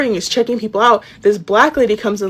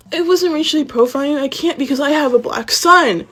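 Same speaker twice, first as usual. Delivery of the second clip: higher-pitched defensive imitation; lightly mocking, pitched-up denial; mocking excuse; 6 semitones higher than usual. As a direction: mimic someone making a feeble excuse. Mimics the manager's excuse.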